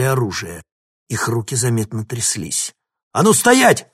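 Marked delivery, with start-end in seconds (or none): 3.1-3.9 loud, high barked order; barked command. Acted. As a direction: bark it like a guard. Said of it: "гаркнул старший".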